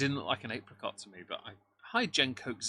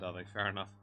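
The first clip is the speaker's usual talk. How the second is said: ordinary talk, nothing marked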